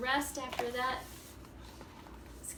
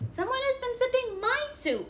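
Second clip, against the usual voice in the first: much higher, mock-cross Mama Bear; Mama Bear's mock growl; 12 semitones higher, 7 dB louder than usual. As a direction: play an offended mother bear, pitched way up. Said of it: "she growled".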